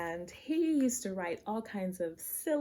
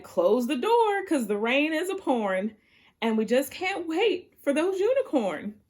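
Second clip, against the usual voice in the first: higher and louder urgent shout; urgent cry; 8 semitones higher, 9 dB louder than usual. Acted. Noah shouting to close the ark's door, higher and louder. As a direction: cry out to shut the door now. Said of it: "Noah cried".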